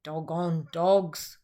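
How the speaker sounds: annoyed shearer grumbling. A narrator's reading. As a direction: grumble at the dogs. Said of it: "grumbled Bungo".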